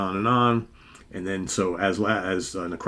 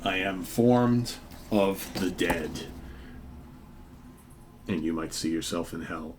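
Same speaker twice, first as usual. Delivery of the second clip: talking to himself; 5 dB quieter than usual. Acted. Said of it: "Says to himself".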